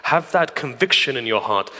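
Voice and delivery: usual speaking voice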